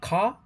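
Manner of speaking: "ka" said with no R at the end, British style; British "ka" without R; non-rhotic car. Shown as a demonstration. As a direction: drop the R in "ka", open long a. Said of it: "In British English, words like".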